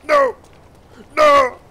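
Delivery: loud high no; loud desperate shriek; panicked scream. Acted. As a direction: scream it in terror.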